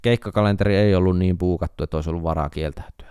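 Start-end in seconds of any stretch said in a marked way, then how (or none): 1.8-3.1 quick quiet aside. Real, not acted. Secret confession aside.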